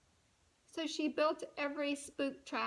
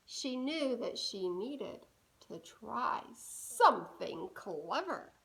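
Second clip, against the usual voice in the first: ordinary delivery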